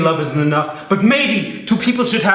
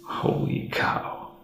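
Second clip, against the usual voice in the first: muttered disbelief; 11 dB quieter, much slower than usual. Acted.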